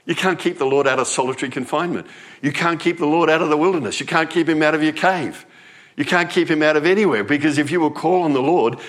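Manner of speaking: rising to a loud, high preaching cry; fervent preaching crescendo; preaching build. Real, not acted.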